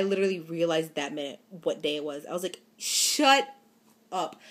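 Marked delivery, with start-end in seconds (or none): 2.8-4.4 high, loud indignant retort; mock angry. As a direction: snap it back at the boyfriend, half playful. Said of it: "I was so mad".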